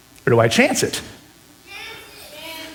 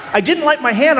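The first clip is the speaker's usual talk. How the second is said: ordinary talk, nothing marked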